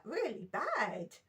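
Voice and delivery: ordinary delivery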